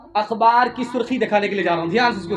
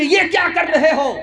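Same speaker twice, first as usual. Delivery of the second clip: higher, lightly voiced alarmed challenge; startled father's question; 5 semitones higher, 4 dB louder than usual. Father asks child what he is doing, mildly acted alarm. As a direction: jump up in pitch, imitating a startled father.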